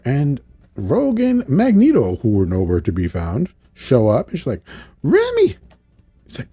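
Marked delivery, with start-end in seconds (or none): 5.0-5.6 very high imitated cry; calling out. Rogue arrives and calls to wounded Remy, high cry.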